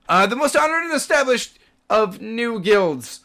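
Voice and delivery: loud, high-pitched official pomp; booming proclamation. Acted. Guard resumes praising the new guild, booming proclamation. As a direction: proclaim it to the whole gate.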